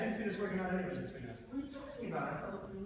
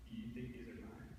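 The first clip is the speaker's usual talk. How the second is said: quiet; 6 semitones lower, 10 dB quieter than usual. Acted. Suspicious quiet questions.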